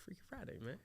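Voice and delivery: hushed whisper, barely voiced. Real, not acted. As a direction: whisper it like a secret. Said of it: "why you whispering?".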